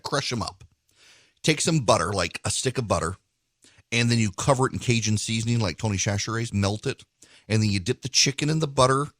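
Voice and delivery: upbeat host ad read, then easygoing recipe chat; host-read radio sponsor spot. Acted. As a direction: sell the steaks like a friendly radio host.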